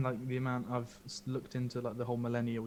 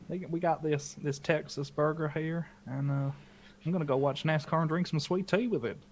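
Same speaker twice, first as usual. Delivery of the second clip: put-on Jen's Texas accent; 5 semitones higher, 5 dB louder than usual. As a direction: play the Texan wife lightly, ending on a loud yeehaw. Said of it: "I'll just try and mimic Jen".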